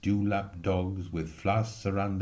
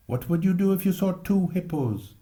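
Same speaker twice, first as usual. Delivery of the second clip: put-on eager boy; 8 semitones higher than usual. Boy asking friends eagerly.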